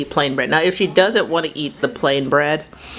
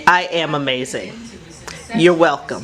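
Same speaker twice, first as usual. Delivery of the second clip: normal talking voice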